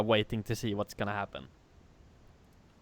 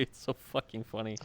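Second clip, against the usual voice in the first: ordinary delivery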